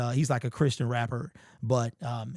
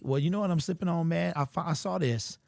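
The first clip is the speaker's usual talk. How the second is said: ordinary delivery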